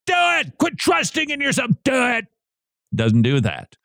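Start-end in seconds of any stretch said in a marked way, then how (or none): 0.1-2.2 mock preacher yell. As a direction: bellow it like a ranting preacher, in mock. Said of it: "would yell at his congregation".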